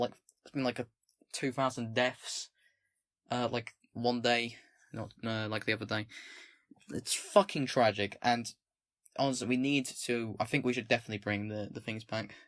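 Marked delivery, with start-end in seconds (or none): none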